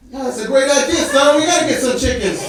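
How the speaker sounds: high, loud, enthusiastic buddy; pumped-up retold endorsement; excited agreement. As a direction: act out a pal jumping at the idea. Roommate acted jumping at idea.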